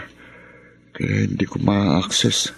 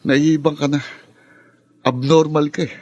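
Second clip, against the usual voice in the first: angry insult; 5 semitones higher than usual. Acted. Insulting brother in argument.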